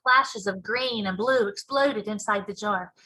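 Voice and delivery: plain reading voice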